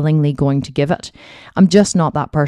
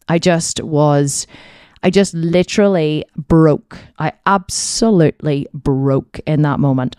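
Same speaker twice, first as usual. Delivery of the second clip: normal talking voice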